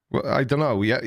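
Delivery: ordinary delivery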